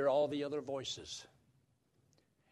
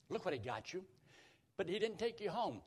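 ordinary delivery

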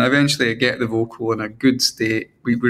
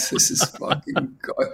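despairing whisper; 3 semitones higher, 6 dB quieter than usual. Retold or imitated. Panicking alone awaiting manager's reply.

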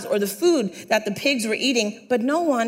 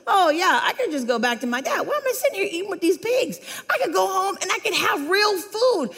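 high-pitched, comic self-talk; mock realization; 9 semitones higher than usual. Prodigal son reasoning with himself among pigs, mock whiny realization. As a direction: play up the dawning bright idea.

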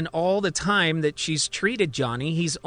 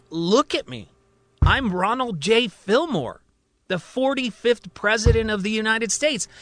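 raised, forceful insistence as president; insistent president; 8 semitones higher, 3 dB louder than usual. Acted. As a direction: proclaim who you are, higher and louder.

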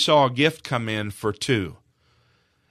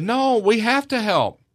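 high, loud, drawn-out insistence; retold adamant plea, pitched way up; adamant children; 12 semitones higher, 7 dB louder, slower than usual. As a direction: plead insistently as the children, refusing to give up. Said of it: "They were adamant".

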